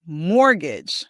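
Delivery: "Mortgage" as MOR-gij, silent T; careful repeat-after-me "Mortgage", stressed up front; first-syllable stress. Shown as a demonstration. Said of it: "The emphasis is on the first syllable".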